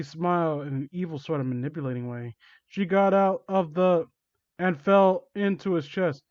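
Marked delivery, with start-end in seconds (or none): none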